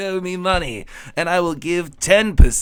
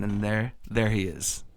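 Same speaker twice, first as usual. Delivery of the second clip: ordinary delivery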